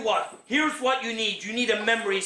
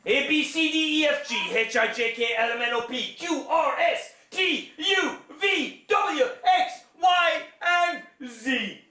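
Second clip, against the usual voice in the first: building excitement; 6 semitones higher, slower than usual. Acted. Joke alphabet building up.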